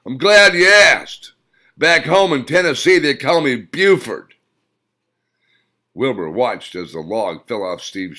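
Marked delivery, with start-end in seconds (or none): 0.0-4.3 brash shout, full voice; yelled. A narrator's reading. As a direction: bellow it across the street at the crowd. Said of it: "as he yelled".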